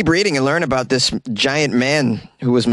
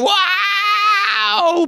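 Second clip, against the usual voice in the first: laughing wow. Real, not acted. Host marvels at own boast.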